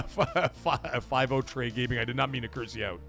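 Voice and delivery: ordinary talk, nothing marked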